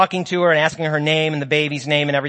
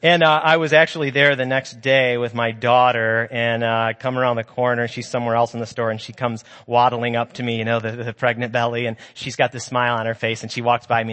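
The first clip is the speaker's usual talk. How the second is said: normal talking voice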